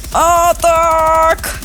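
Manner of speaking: high, drawn-out cry; excited exclamation. Real, not acted. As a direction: call it out high and long. Reaction during play. (збуджений вигук)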